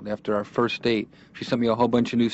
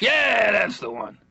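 put-on higher old grandfather, pleased; old grandpa voice; 11 semitones higher, much slower than usual. Grandson imitates grandfather agreeing, old man voice. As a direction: play the grandpa confirming it. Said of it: "He's like".

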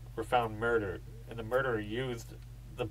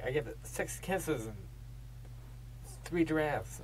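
higher, softer quoted voice; imitating Johnny; 5 semitones higher, 7 dB quieter than usual. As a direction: lightly mimic Johnny's silly rating. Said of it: "He's like".

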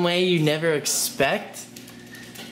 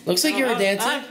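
higher, cheerful pet-talk; higher playful voice to a pet; 7 semitones higher than usual. Real, not acted. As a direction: encourage the dancing animal. Talking to dancing pet.

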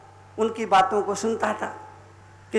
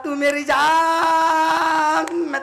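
very slow, higher, stretched words; drawn-out punchline; 5 semitones higher, 3 dB louder, slower than usual. Acted. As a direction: hold each key word long. Drawn-out pun for the crowd.

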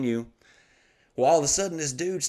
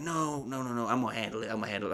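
much softer, hushed placating voice; retold soothing manager; 2 semitones lower, 12 dB quieter, faster than usual. Manager calming an upset comedian, retold in a soothing hush. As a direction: quietly wave the problem away as the manager.

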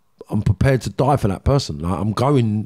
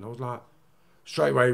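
ordinary talk, nothing marked

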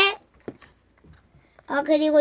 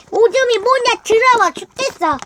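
put-on cutesy baby talk; 6 semitones higher, 3 dB louder than usual. Toy character voice.

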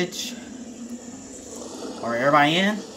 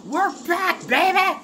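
high-pitched victory cheer; gleeful triumph; 12 semitones higher than usual. Real, not acted. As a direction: crow it, giddy and high. Celebrates a comeback in battle.

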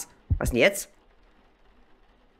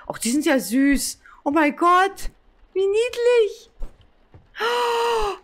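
cutesy squeal; slower than usual. Real, not acted. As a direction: coo over something adorable. Gushing over cute plague doctors. (quietschend hohe Verzückung)

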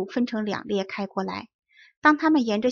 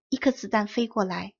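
usual speaking voice

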